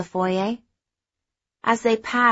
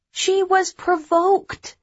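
high, slow defensive protest; 11 semitones higher, much slower than usual. Acted. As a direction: defend her, a bit indignant. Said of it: "I said defensively".

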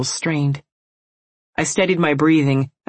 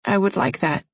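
sleepy; 4 semitones higher than usual. Acted. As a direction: drift off as you say it. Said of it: "she said sleepily".

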